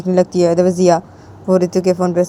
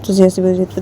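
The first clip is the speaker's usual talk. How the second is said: ordinary delivery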